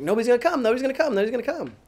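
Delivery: high-pitched imitation of panicky naysayers; hammed-up high worry; mock freak-out. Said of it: "everybody was freaking out".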